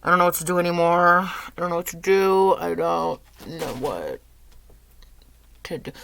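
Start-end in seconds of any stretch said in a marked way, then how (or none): none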